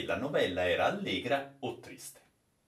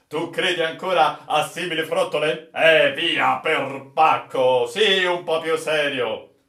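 mocking captain; 5 semitones higher, 9 dB louder than usual. Captain mocks the old sailor.

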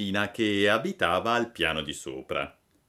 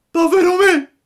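much higher, much louder scream of despair; shrieking despair; 15 dB louder than usual. A narrator's reading. Pinocchio in full despair, screaming louder. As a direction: let the second cry burst out. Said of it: "gridò Pinocchio".

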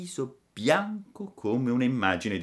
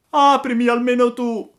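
high, very loud desperate plea; tearful pleading cry; 11 dB louder than usual. A narrator's reading. Hunted puppet begs girl to open, tearful shout. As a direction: cry out and beg at the top of your voice. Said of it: "gridò Pinocchio piangendo e raccomandandosi".

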